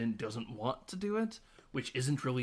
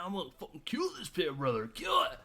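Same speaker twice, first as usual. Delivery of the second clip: fast, rising to a high yelp; macho bro hype; 5 semitones higher than usual. Retold or imitated. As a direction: pump it up like a dumb bro. Voicing macho friends.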